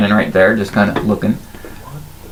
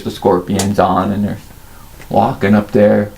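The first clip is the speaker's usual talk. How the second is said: usual speaking voice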